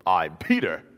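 high, loud cocky bragging with a laugh; boastful Peter. Retold or imitated. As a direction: brag like you'd never fail. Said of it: "And then Peter's like".

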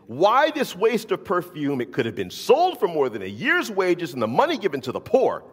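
mock the disciples' outrage; indignant retelling. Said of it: "they're indignant".

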